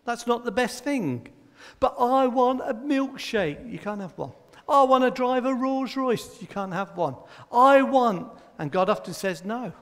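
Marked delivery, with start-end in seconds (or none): 1.8-3.7 higher, slow mock child whine; lightly voiced pleading child; whiny child. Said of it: "his answer is no". 4.7-6.4 lightly voiced pleading child; whiny child. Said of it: "his answer is no". 7.5-8.3 higher, slow mock child whine; lightly voiced pleading child; whiny child. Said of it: "his answer is no".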